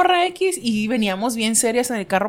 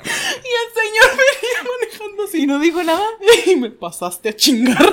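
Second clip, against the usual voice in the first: high, crying with laughter; laughing through tears; 11 semitones higher, 6 dB louder than usual. Real, not acted. Teller crying with laughter over her blunder. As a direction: tell it while dying laughing.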